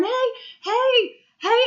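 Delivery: high-pitched retold holler. Mom still hollering at sleepwalking son, high holler. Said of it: "I'm still hollering".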